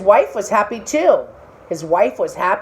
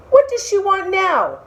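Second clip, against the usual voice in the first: high, weary fish voice; a fish losing patience; exasperated fish; 11 semitones higher than usual. Acted.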